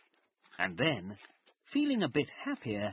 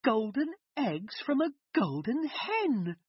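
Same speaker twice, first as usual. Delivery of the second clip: excited cry; 11 semitones higher than usual. A narrator's reading. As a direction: cry out with glee. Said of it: "cried Jack's mother".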